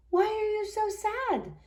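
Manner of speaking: high, soft questioning voice; gently asked worry; a husband's soft concern; husband asking. A narrator's reading. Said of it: "asked her husband".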